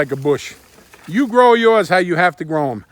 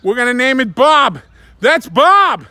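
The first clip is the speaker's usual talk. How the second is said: high, loud playful naming; playful excitement; 6 semitones higher, 7 dB louder, slower than usual. Real, not acted. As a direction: announce it with glee.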